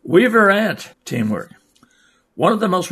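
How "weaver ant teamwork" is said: bright, louder title read; headline announcement. Acted. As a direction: announce the next story like a radio host.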